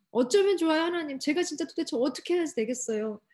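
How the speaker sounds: high-pitched, imploring acted prayer; desperate appeal, acted out; crying out to God. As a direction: plead aloud as if at a loss. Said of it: "하나님한테 부르짖으면 좋지 않겠어요".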